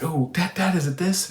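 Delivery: a hint of an excited kid asking dad; mild childlike eagerness; eager little son. Son picking toys in parable.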